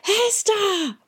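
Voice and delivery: high desperate shout; boy shouting for the girl a second time; shouting again. A narrator's reading. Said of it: "he shouted, so loud it hurt his throat".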